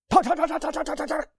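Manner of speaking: high, rapid-fire repeated syllables; staccato burst of chatter on one high note; machine-gun chatter. Shown as a demonstration. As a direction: rattle the word off like gunfire. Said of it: "like a machine gun".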